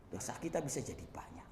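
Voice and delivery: soft unvoiced warning; hushed whisper of warning. Real, not acted. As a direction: whisper it like a grave secret. Preacher drops to warning.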